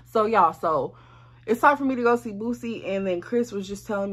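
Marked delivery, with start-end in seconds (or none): none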